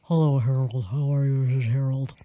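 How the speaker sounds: low-pitched dad greeting; Harold. Acted. Host plays her dad introducing himself to another dad, low voice. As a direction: sink the voice deep and formal. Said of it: "I should do Harold's voice".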